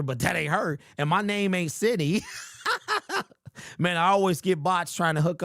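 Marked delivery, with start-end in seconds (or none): 2.3-3.2 short high run of giggles. Real, not acted. Host laughing at being called the wrong name, high giggle. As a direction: giggle at your own remark.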